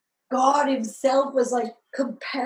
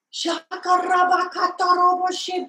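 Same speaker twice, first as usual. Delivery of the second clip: high, loud mock tongues-speaking; hammed-up preacher speaking in tongues; praying in tongues; 7 semitones higher, 5 dB louder than usual. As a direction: gush out tongues like a fervent preacher. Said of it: "praying in tongues".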